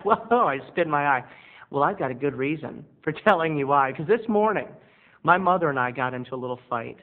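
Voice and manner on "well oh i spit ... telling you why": ordinary talk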